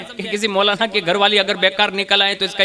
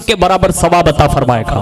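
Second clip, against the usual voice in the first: louder, much lower booming finish; preacher to the congregation, booming close; preaching climax; 4 semitones lower, 6 dB louder than usual. Real, not acted. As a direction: proclaim the reward from the pulpit. Sermon climax on reward.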